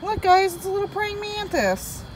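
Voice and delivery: high, delighted voice to the viewers; excited discovery. Real, not acted. Vlogger shows viewers a praying mantis, high and delighted. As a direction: squeal a little at the tiny bug.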